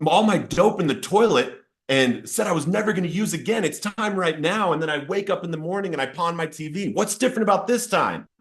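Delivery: high-pitched, exasperated challenge to God; retold anger. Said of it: "I felt anger".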